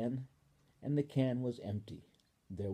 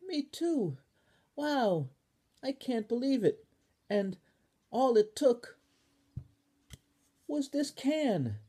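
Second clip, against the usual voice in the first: much higher, excited squeal; thrilled Ball; 13 semitones higher, 5 dB louder than usual. Acted. Ball to Spoon, high and thrilled. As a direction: bubble over with wonder.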